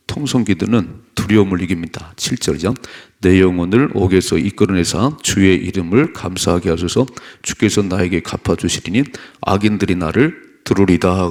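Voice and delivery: loud, declarative preaching; forceful scripture reading. Real, not acted. Preacher reads the psalm on fear, forceful. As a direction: read it out firmly to the congregation.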